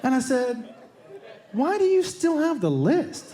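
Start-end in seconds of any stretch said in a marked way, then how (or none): 1.5-3.3 incredulous. Retold or imitated. Incredulous question to brother.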